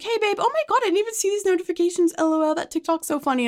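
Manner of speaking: high, bubbly imitation of the boss, fast; mock bubbly boss. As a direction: squeal it like the carefree boss. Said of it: "the next day she's like".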